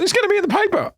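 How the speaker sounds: hammed-up thrilled father; imitating excited dad. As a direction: play the chuffed dad who believes it. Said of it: "he's like".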